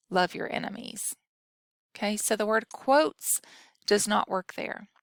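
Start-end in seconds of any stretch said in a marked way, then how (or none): 0.1-1.2 whispered example sentence; soft unvoiced whisper. Shown as a demonstration. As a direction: read it under your breath. Reading example sentence aloud.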